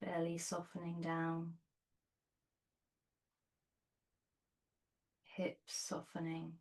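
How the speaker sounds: soft, unhurried meditation voice with long pauses; guided meditation. Real, not acted. Yoga teacher settling students onto their bolsters, soft guided meditation.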